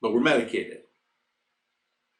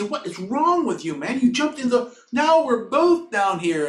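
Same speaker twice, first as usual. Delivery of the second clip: high, loud exasperated protest; incredulous outburst; 14 semitones higher, 11 dB louder than usual. Retold or imitated. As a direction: yell at a friend who made things worse. Quoted outburst at friend who jumped in.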